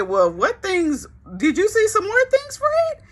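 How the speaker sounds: high, eager squeaky questions; nosy wife pressing for details in a high voice; curious wife. Retold or imitated.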